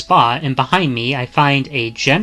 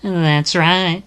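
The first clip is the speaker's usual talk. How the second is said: put-on higher, lilting voice of a blunt young woman; teasing young woman; 7 semitones higher, slower than usual. Young woman teasing a stranger she just met, higher and sing-song. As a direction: tease the stranger sweetly while insulting him.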